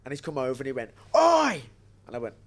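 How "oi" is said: sharp high yell of oi; shouted oi. Retold or imitated. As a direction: bark it out to get attention. Promoter running over yelling.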